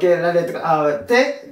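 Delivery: normal talking voice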